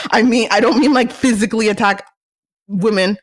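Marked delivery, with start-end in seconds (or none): none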